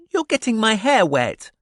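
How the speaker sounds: put-on high, loud little-girl complaint; accusing. A narrator's reading. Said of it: "she accused".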